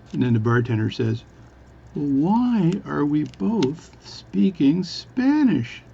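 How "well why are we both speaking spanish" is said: high, slow, swooping comic question; really slow speech. Acted. Bartender's puzzled punchline to the Newfie, really slowly. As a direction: draw out the punchline word by word. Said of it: "is speak really slowly".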